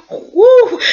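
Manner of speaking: loud, high "woo"; exclaimed whoop. Real, not acted. Reviewer reacting to the shoe-licking demand, loud high whoop. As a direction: let out a sharp, high hoot.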